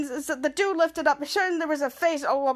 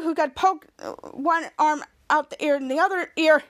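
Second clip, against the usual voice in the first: normal talking voice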